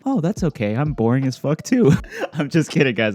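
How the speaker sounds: playful laughing impression of a bored woman; laughing mock Siyun. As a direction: giggle out the punchline as Siyun.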